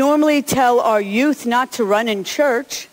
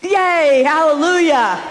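joyful cheer; 5 semitones higher, 4 dB louder, slower than usual. Real, not acted. Pastor cheering after child's run.

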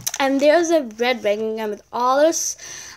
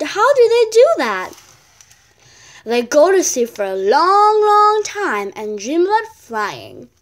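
loud, lively read-aloud voice for a picture book; bright storybook reading; reading a story aloud; 4 semitones higher, 8 dB louder than usual. Acted. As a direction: read it out to the class with energy. Child reads story aloud.

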